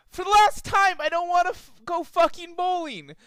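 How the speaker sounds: high, loud exasperated snap; fed up, bite it off; angry refusal. A narrator's reading. Twilight refusing her cousin Celestia, loud and exasperated.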